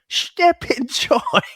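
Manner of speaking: very high, drawn-out mangled Cockney, TIME as "choim". Retold or imitated. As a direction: squawk out a hammed-up bad Cockney impersonation. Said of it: "the way he delivers it is just like".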